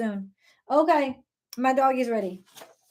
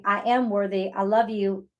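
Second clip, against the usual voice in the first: normal talking voice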